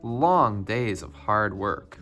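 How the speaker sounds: ordinary delivery, nothing marked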